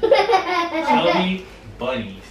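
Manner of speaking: slow, stuffed-mouth giggle; laughing with full mouth. Real, not acted. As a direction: laugh the words through marshmallows. Chubby bunny marshmallow challenge.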